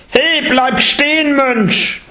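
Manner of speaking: high, slow shout after the monk; calling out. Acted. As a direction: yell at him to stop, slow and high. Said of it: "rief Angulimala". (hoher, langsamer Ruf: Bleib stehen!)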